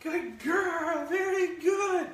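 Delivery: high, sing-song praise for the dog. Real, not acted. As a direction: lift the voice high to reward her. Praising dog during training.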